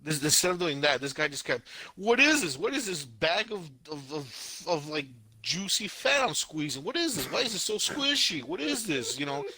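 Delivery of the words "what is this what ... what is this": high-pitched, incredulous questioning; mock bafflement. Podcaster acting out the anime guy squeezing, mock bafflement. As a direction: puzzle over it in a put-on high voice. Acts out guy's baffled groping.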